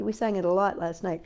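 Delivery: ordinary delivery, nothing marked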